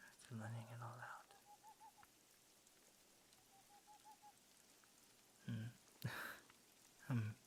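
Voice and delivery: very soft, hushed reassurance; soft comforting whisper. Acted. Werewolf comforts the crying listener in his arms, softly whispered. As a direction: murmur gently while holding them close.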